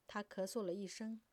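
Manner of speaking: usual speaking voice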